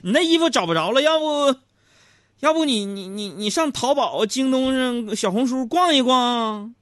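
mock exasperated shouting, pitched very high; fed-up outburst. Husband fed up with wife's messy clothes search, exasperated high shout. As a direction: blow up comically at the wife, high and loud. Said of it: "我实在受不了了".

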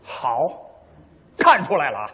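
normal talking voice

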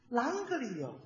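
soft Sichuan accent, voice sliding down. Shown as a demonstration. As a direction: let the Sichuan pitch slide down quietly. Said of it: "他得形容出来是".

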